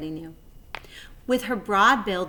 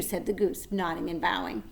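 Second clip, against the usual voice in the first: usual speaking voice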